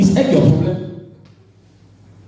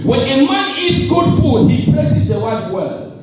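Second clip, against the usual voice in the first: slow emphatic; 6 semitones higher, slower than usual. Shown as a demonstration. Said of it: "You did not get this one".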